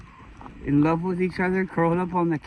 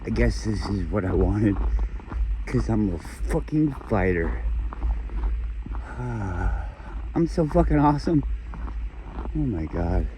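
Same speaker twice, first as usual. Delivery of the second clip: unusual low voice; 7 semitones lower than usual. Real, not acted. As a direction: drop way down into a low, weary register. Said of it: "don't normally talk like this".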